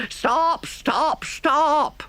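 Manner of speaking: very high mock shouted stop; imitating shouting. Driver imitating the pursuers shouting stop. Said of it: "all shouting the same thing".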